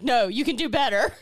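loud coaching push; hype man. Retold or imitated. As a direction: urge them on firmly and loudly. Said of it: "her hype man".

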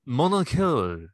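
slow, exaggerated French pronunciation of Mon Oncle; French accent. Shown as a demonstration. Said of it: "I just wanted to sound cultured".

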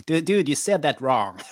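light American-sounding nerd voice; a hint of a nitpicking comic-store nerd; Comic Book Guy nerd voice. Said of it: "when you have a nerd voice".

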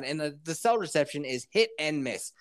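usual speaking voice

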